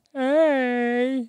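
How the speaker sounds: high goofy dino voice; stegosaurus voice. Pretend. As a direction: voice a silly little dinosaur. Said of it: "here's my, uh, stegosaurus".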